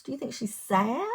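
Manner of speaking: usual speaking voice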